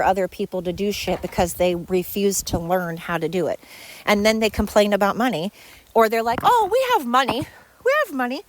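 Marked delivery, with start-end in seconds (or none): 6.4-8.4 high, airy mock boast; mocking imitation. Imitating the lazy owners bragging about money, mocking. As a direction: mimic them in a higher voice.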